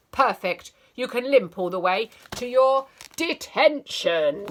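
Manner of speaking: loud, sneering jab; cruel teacher. Acted.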